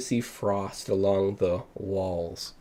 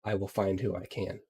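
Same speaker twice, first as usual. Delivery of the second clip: softer, dropping to near whisper; fading frightened explanation; quiet frightened voice; 9 dB quieter than usual. Acted.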